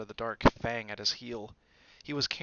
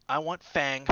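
quick; 4 semitones higher, 6 dB louder than usual. A narrator's reading. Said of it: "said Malfoy quickly".

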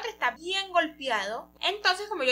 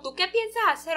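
higher-pitched mock indignation; indignant protest; 6 semitones higher than usual. Bao protests to the man pulling his braid, indignant. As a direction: challenge him, pitch jumping up.

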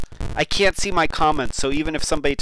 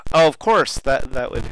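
ordinary talk

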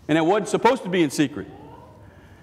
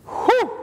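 high exhaled whew. Real, not acted. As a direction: blow out a big whew after the reading. Reacting to dramatic text.

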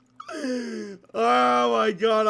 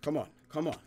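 ordinary delivery

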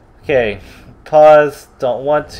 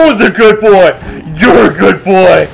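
put-on doggy baby talk. Voicing dog praise.

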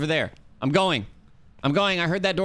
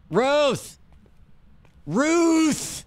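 high, drawn-out loud calls; hollering the name into the dark; calling out; 4 dB louder than usual. Real, not acted. Summoning the ghost Ruth by name, loud calls.

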